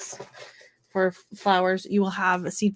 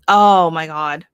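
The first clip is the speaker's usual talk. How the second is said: slow, louder drawn-out oh my God; disgusted; 9 dB louder, much slower than usual. Real, not acted. Reacting to the dog vomit fungus with slow loud disgust. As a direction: recoil at something gross, slowly.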